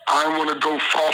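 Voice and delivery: normal talking voice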